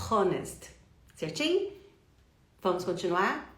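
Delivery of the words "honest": "honest" with a breathy H in front; faulty "honest", audible breath before the O; wrongly sounded H. Shown as a demonstration. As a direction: say "honest" as a mistake, H aspirated.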